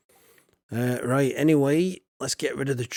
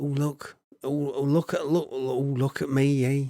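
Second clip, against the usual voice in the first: ordinary talk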